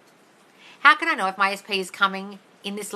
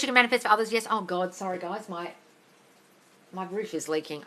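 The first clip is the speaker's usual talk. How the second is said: normal talking voice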